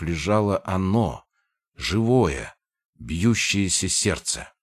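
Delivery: ordinary delivery, nothing marked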